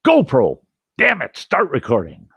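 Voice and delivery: retold near-yell of an angry camper; almost yelling. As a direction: nearly shout the command in frustration. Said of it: "I'm almost yelling".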